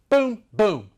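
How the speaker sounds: ordinary talk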